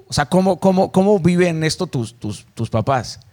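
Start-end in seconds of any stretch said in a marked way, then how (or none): none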